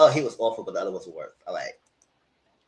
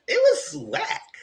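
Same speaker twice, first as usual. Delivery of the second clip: disgusted; much slower than usual. Real, not acted. High slow disgusted remark.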